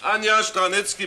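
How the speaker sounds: loud, slow call across the studio. Acted. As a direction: call her name over the set. Director calls actress across studio.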